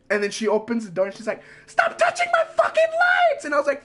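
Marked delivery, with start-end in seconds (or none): 1.7-3.4 very high, shrieking teacher voice; mock screaming teacher. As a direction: imitate her furious screech. Teacher yelling at him.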